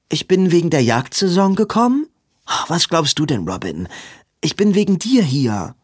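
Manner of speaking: half teasing, then earnest; a hint of an exasperated girl; sister Maud. Maud answers her brother.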